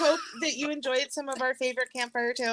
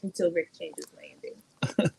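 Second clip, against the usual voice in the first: mock whisper; 7 semitones lower, 3 dB quieter, slower than usual. Real, not acted. Joking aside about Rick.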